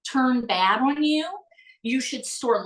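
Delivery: ordinary talk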